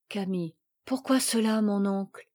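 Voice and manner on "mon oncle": ordinary delivery, nothing marked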